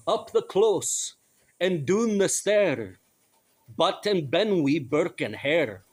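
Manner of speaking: slow, singsong mock Scottish accent; attempted Scottish accent. Host reciting a nineteenth century poem, hammed-up Scottish accent. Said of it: "in my best accent".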